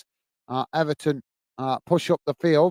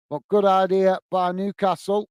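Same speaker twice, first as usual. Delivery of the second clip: normal talking voice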